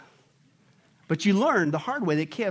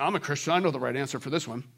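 a hint of a smug churchgoer, lower and quieter; smug know-it-all; 8 semitones lower, 5 dB quieter, faster than usual. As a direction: toss it off like you already know. Smug churchgoer voiced by preacher.

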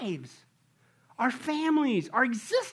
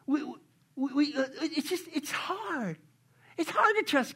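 acted whining; 3 semitones higher, 4 dB quieter than usual. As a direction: whine like a reluctant doubter. Acts out a doubter's complaint.

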